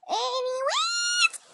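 very high, long drawn-out squeal; exaggerated segue. Real, not acted. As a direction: stretch it out sky-high to change subject. Breaks off own tangent loudly.

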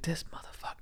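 retold reaction in a hushed whisper; whispered aside. Reacting to the loaded dinner plate, whispered aside. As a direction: whisper it under your breath.

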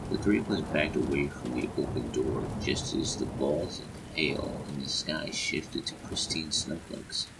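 usual speaking voice

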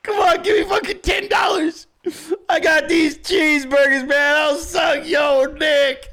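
high, crying, hammed-up plea; mock desperate begging. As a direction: wail it like a pathetic beggar, drawing out the end. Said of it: "Bro was out here like".

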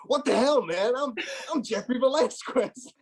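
high, laughing put-on American accent; mock American accent. Teammate mimics a mate faking an American accent at the door. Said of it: "I was doing the American accent".

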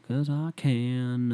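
put-on country accent. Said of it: "Why do I have a country accent?".

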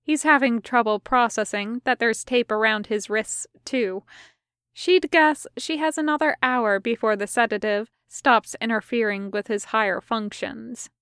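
ordinary delivery, nothing marked

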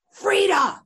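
high, loud exclamation; mock calling out. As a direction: call it out suddenly, imitating someone. Imitates star calling out to her.

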